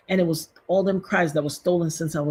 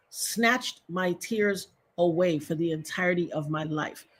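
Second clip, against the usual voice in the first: usual speaking voice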